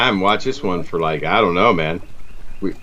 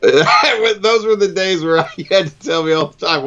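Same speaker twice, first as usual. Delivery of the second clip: words breaking up with real laughter; laughing while talking; 7 semitones higher than usual. Real, not acted. Host reminiscing to friend over the old footage, laughing. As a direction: talk through helpless giggles, higher.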